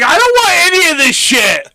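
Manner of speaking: high, loud, fed-up shout; exasperated shout. Retold or imitated. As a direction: shout it out, sick of all the upselling. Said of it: "I wanted you to be like".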